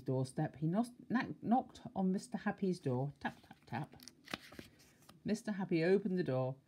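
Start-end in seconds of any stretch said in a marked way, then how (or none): 3.2-4.0 soft, hushed little taps; timid knock, barely sounded; gentle tapping. A narrator's reading. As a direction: whisper the taps delicately. Quiet man knocks softly.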